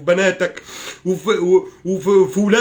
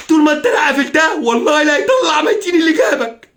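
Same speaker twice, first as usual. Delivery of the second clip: higher and louder, yelling the threat; furious shout; 6 semitones higher, 4 dB louder than usual. Real, not acted. Yelling at those who keep silent, furious. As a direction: explode at the silent viewers.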